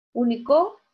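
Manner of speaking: "Único" with final CO stressed and rising; stress on last syllable. Shown as a demonstration. Teacher testing options for "Único", last-syllable stress. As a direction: wrongly land the stress of "Único" on CO. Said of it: "la mayor fuerza de voz".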